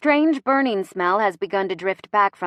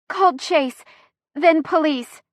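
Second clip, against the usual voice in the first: high fragments split by a pause; pained; 8 semitones higher, 4 dB louder than usual. A narrator's reading. Chrissy reports her phone calls, pained. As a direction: squeeze out each bit through pain. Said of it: "She shudders with pain".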